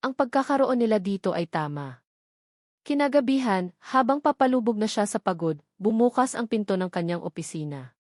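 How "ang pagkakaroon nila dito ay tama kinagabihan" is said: ordinary delivery, nothing marked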